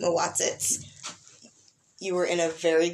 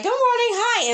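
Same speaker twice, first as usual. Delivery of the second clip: much higher, louder bright hello; chirpy greeting; 5 dB louder than usual. Retold or imitated. Greeting people each morning, chirpy higher hello. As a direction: sing it out to coworkers. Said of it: "I'm just like".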